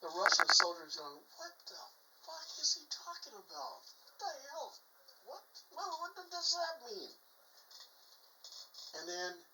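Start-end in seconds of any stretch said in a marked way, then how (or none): 1.4-7.1 high, hushed bafflement; acted bewilderment, pitched way up but kept soft; confused. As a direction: squeak out quiet, baffled questions. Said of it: "looks kinda confused".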